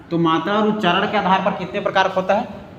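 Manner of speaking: ordinary delivery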